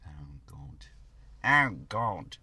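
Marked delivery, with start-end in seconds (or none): 0.1-0.8 whispered, very soft. Real, not acted. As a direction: breathe it out silently. Whispered, then said aloud.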